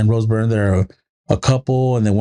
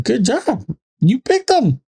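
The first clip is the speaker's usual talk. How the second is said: very high, teasing praise; mock cheering dad; 11 semitones higher than usual. Dad reminds daughter she chose decorations, high mock praise. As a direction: pipe it high, retelling a dad's reply. Dad's retold reply to daughter.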